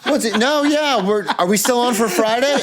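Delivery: high, laughing friendly small talk; chatty neighbor. Retold or imitated. Neighbors chatting in the road, high and laughing. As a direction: gush like a neighbor catching up. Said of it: "They'd be like".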